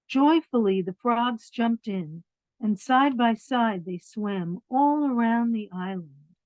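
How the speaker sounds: lively storybook reading; reading to children. A narrator's reading. Reading a picture book to children, lively storybook voice. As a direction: read it out warmly to the little ones.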